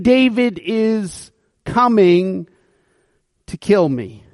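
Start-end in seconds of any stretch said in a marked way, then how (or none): none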